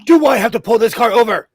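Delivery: loud, high parent threat; stern parent. Retold or imitated. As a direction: threaten the kids from front seat. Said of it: "I would be like".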